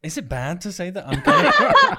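giggling through a confession; laughing. Real, not acted. Host laughing at her own admission, real laughter.

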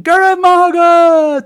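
high, slow, loud mock cheer; children shouting. Schoolchild yelling thanks at a camera, loud and high. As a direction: bellow it like a kid at the camera. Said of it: "individual children will be shouting".

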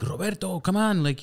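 urgent calling. Retold or imitated. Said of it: "they're like".

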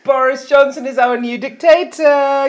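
big loud cheering proclamation; excited announcement. Pretend. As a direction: announce it like huge news. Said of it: "I have an announcement to make".

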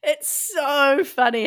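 laughing through the words, high. Real, not acted. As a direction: giggle it out, amused. Laughing while agreeing about Gizzy.

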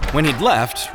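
plain reading voice

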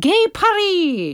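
high, drawn-out announcement; gleeful flourish. Acted. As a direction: stretch it out grandly, high-pitched. Announces letter's city with flourish.

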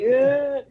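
loud, high-pitched sob noise; mock bawling wail. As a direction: imitate yourself bawling with one wail. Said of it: "I'm, like, bawling".

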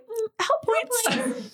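high, slow, teasing mock mom; lightly voiced knowing mother. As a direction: drag it out like a mother who caught you. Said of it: "she'll look at you like".

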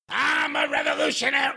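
high, far mocking voice; mocking impression. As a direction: sneer out a grand boast. Mocking wrestler's revolutionary claim.

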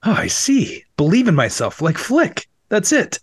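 high, eager epiphany; excited realization. Acted. Rudy has a revelation.

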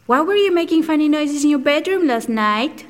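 lightly voiced little kid pestering his parents; a hint of a curious kid; child's voice. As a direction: ask like an innocent, nosy child. Imitating himself as a child.

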